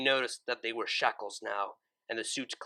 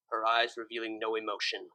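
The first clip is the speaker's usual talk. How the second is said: plain reading voice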